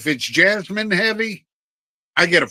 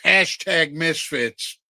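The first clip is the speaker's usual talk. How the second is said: slow slogan; much slower than usual. Real, not acted. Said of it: "slogan drawn out".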